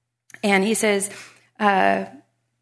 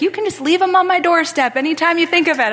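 high, loud retold suggestion; neighbor's helpful nudge; 9 semitones higher, 6 dB louder, faster than usual. Neighbor suggests doorstep drop-off to speaker, high mock nudge. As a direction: play the neighbor pressing the hint, raised.

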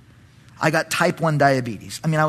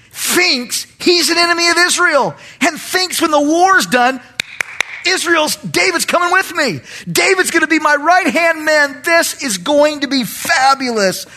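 delighted; 11 semitones higher, 4 dB louder than usual. Acted. Acting out Achish's delighted thoughts.

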